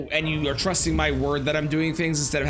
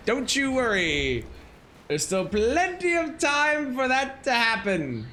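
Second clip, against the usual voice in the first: sinister taunt; 6 semitones higher, slower than usual. Pretend. Ominous teasing threat to Jory.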